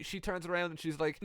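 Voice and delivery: ordinary talk, nothing marked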